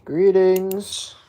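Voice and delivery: loud announcer-like hello; loud greeting. Real, not acted. Owner greeting viewers, loud and projected.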